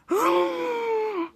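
high, drawn-out mock gasp; shocked outcry. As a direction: let out a long high gasp. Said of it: "Brainstorm shout out".

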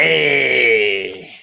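long, sliding "Hey"; Fonzie. Acted. Co-host showing where the catchphrase comes from, Fonzie style. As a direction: draw it out like a sitcom greaser.

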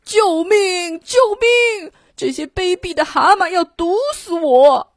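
high cries of 救命; the monster shrieking in fear; panicked shout. A narrator's reading. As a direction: shriek for help in terror. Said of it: "大叫起来".

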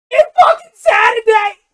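hammed-up podcast bellow off the mic; imitated yell. Mimicking a comedian yelling on his podcast, hammed-up shout. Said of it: "He gets away from the mic like this".

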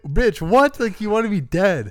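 high, loud incredulous outburst; mock outrage. Husband confronting the wife who wanted him dead, loud and high. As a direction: blast it as a betrayed husband. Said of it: "And he's like".

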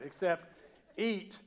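ordinary talk, nothing marked

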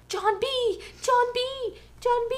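high whiny mock cries of John B; mocking a tearful TV character calling John B; mock crying Sarah. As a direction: ham up the tearful girl calling out. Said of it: "she's either crying or always on the verge of tears".

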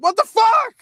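very high, loud squeal of fright; terrified shriek. Retold or imitated. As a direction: shriek as the shadows close in. Retold shriek as shadows approach.

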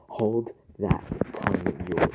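loud, menacing shout of the line; screamed threat. Acted. Wife turning on her husband screams the threat at him. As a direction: snarl it out at full volume as a threat. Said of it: "I screamed".

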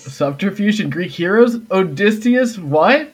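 amused mock outrage breaking into a laugh; laughing disbelief. Real, not acted. GM teasing the players about Odysseus, high laughing disbelief.

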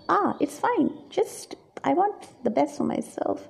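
acted casual self-indulgence; breezily dismissive. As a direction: wave the cost away lightly. Acts out carefree spending on shoes.

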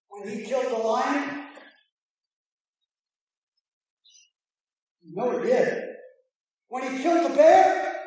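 loud, slowed pulpit shout; fiery sermon climax; preaching at full force. Acted. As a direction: drive the point home like a revival preacher.

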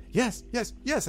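high, urgent "yes, yes"; a goblin pointing and insisting. Acted.

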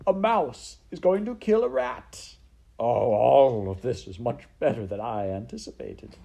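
theatrical, gloating delight; Botticelli. Acted. Rat relishes a mouse about to kill a rat, gloating delight. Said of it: "He slapped his tail on the floor in approval".

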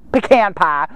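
Southern US pronunciation. Shown as a demonstration. Preacher showing how the South says pecan, Southern accent. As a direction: say "pecan pie" as they do in the South. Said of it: "or if you're in the South".

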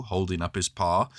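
ordinary delivery

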